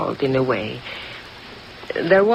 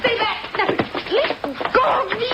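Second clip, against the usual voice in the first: much higher, frantic; struggling panic. Acted. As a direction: fight him off in panic. Struggling with detective.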